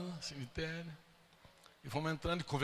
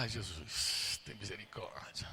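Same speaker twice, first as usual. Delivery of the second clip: a soft, lowered "ai, Jesus" under the breath; quiet anxious plea; 7 semitones lower, 7 dB quieter, slower than usual. Retold or imitated. Retelling his dread as the stepmother nears the car, softly pleading. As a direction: murmur it like a nervous prayer. Dreads mother-in-law spotting mother.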